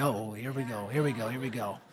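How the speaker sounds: low, hushed mutter to himself; uneasy aside. Retold or imitated. Preacher to himself as the big guy whispers to the girl, muttering low. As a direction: brace for trouble under your breath. Bracing himself as trouble brews.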